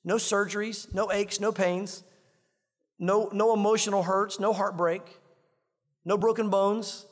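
usual speaking voice

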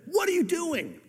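put-on sharp high "What are you doing?" to a child; alarmed parent. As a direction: snap it like a scared parent. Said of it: "How are you gonna deal with that?".

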